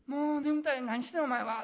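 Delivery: loud, high, annoyed Kansai grumble; 大阪弁で不機嫌に; grumpy Osaka dialect. Pretend. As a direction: woken friend snapping back in Osaka dialect. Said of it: "っていう感じですね".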